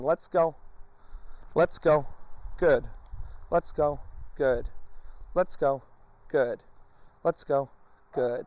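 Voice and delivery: cue and reward in a steady rhythm; repeated command. Shown as a demonstration. Trainer repeating the command to the dog. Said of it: "repeat the name of the command".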